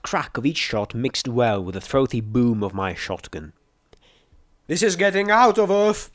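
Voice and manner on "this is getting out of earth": high-pitched call from a distance; raised, urgent warning call. A narrator's reading. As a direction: call down into the pit. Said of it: "P twenty-one called from the lip of the pit".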